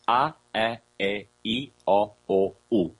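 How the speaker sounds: A, E, I, O, O, U sung out as pure vowels; A, E, I, O, O, U spoken as sacred vowel sounds; seven pure vowels. Shown as a demonstration. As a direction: intone A, E, I, O, O, U slowly and steadily. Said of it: "the true sound of the name of God".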